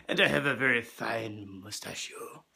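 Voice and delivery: low, quiet pompous boast; deep boast. Acted. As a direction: puff up about the mustache.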